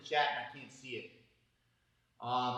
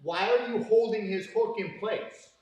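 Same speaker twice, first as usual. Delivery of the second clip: higher, teasing scold of a student; retold scolding; 6 semitones higher, 4 dB louder than usual. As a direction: needle him like a fed-up coach.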